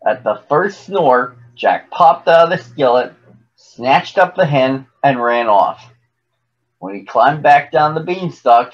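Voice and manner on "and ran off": ordinary delivery, nothing marked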